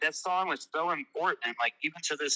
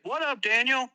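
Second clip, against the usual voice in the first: much higher, friendly imitated hello; 11 semitones higher, 3 dB louder than usual. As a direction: toss out a casual high greeting. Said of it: "And it was fucking [name]".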